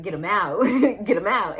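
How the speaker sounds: loud pleading shout with a laugh; desperate yell. Retold or imitated. Mother in labor begging nurses, loud yelled plea. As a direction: yell it like you need this over now. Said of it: "And I was like".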